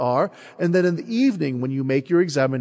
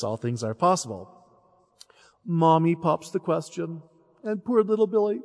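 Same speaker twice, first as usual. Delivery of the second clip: ordinary delivery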